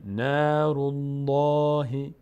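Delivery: نار الله chanted slowly with a thick, dark ll; full, heavy lam. Shown as a demonstration. Teacher showing Allah read full after damma, heavy chanted lam. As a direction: open the mouth upward for a full الله.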